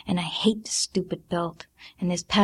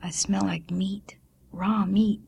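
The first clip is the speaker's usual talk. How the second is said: lowered voice. A narrator's reading. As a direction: drop the voice to tell a secret. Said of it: "Celestine lowered her voice".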